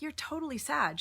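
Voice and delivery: high, amused accusation; teasing cousin. Retold or imitated. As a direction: tease her like a knowing cousin. Cousin teasing her on street.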